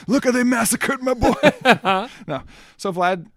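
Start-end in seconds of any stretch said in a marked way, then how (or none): 0.1-1.3 hammed-up dying wife's plea. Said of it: "she's like". 1.4-2.2 laugh. Real, not acted. Laugh after quoted line.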